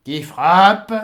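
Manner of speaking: légère voix rauque et plaintive, plus forte; un gardien qui se plaint; voix rauque et dolente. Acted. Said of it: "une voix rauque et dolente". (une voix rauque et dolente à peine marquée)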